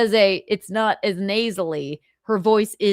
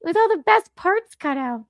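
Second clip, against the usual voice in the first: Chrissy Mayer impression; 12 semitones higher, 4 dB louder than usual. Imitating streamer Chrissy with a high, thin voice. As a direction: lift the voice up high as a light female impression. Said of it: "without making her sound like Mickey Mouse".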